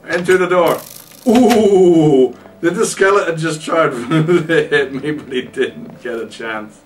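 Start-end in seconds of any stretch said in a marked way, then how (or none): 1.3-2.4 startled. Real, not acted. Skeleton attack. 2.6-5.1 laughing. Real, not acted. Laugh heard.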